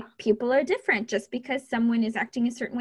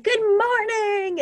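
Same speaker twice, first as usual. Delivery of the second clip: high, drawn-out, sing-out chipper hello; chipper greeting; 10 semitones higher, 4 dB louder, much slower than usual. Retold or imitated. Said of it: "very outgoing and very chipper".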